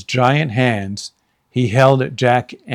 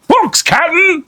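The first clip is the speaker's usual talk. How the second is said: high, loud shout down; Pinky shouting from the treehouse; shouting pirate; 4 dB louder than usual. Acted. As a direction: call it down to the captain. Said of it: "Pinky shouted".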